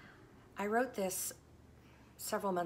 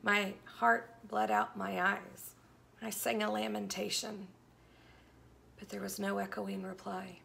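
earnest devotional poetry reading; dramatic poem reading; 5 dB louder than usual. Acted. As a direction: read it like a heartfelt lament.

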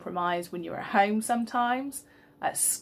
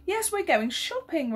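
forced smile; 10 semitones higher than usual. Acted. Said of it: "with a feeble attempt at a smile".

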